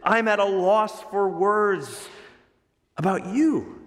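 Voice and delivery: a hint of a shocked spiritual father; amazed. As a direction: voice Paul's shock, each phrase drawn out. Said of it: "Paul says".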